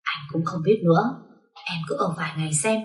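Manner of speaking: a playful hushed tease, dropping away to almost nothing; whispering. Acted. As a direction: lean in close to her ear and hush it. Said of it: "thì thầm mà nói".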